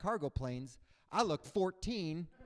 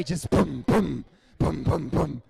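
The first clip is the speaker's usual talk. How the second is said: loud punchy boom sounds; mock booms; 10 dB louder, slower than usual. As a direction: thump each boom out. Imitated clenching sounds.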